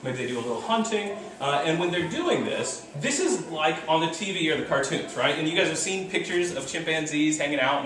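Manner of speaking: ordinary delivery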